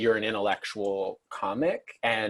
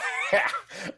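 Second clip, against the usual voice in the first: high breathless laugh. Real, not acted. Laughing at own note.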